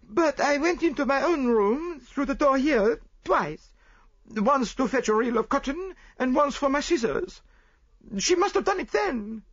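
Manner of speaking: high, loud, slowed pleading voice; French maid. Acted. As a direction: defend yourself in a high strained voice. Celestine explains herself.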